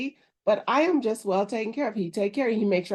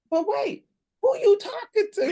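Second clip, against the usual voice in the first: much higher, sassy disbelief; mock outrage; 14 semitones higher, 3 dB louder than usual. Wife replaying the exchange, high sassy disbelief. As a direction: throw it back, incredulous. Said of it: "I was like".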